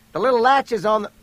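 fast, high, loud explanation; hurried shout, cut off; urgent instruction. A narrator's reading. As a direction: rush the instruction out loudly. Hurried gun instructions under fire.

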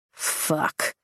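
low whispered curse under breath; muttered curse. A narrator's reading. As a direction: mutter it, barely voiced. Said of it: "Xaden mutters".

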